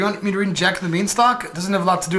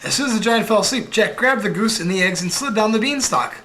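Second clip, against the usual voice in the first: ordinary delivery, nothing marked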